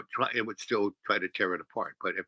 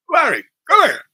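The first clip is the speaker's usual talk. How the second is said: much higher, loud call to a dog; mock yelled command; 14 dB louder than usual. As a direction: bark the dog's name as a command. Owner calls his dog.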